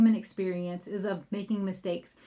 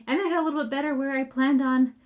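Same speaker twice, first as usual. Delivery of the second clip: normal talking voice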